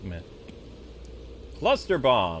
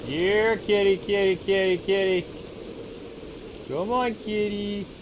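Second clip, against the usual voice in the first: high sing-song cat call; 7 semitones higher than usual. Real, not acted. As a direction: coax it like calling a pet. Calling a tiger like a pet.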